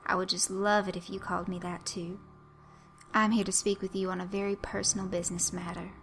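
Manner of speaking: a caricatured Southern dandy, ever so polite; Southern dandy accent. Acted. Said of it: "He had a Southern accent".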